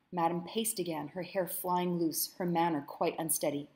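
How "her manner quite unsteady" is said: ordinary delivery, nothing marked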